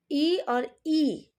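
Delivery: ई और ई sung out very slowly, each vowel held long; ई और ई, slow and held for students; drawn-out vowel demo. Shown as a demonstration. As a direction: stretch each vowel so the class hears it clearly. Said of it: "जब हम ई और ई का उच्चारण करते हैं".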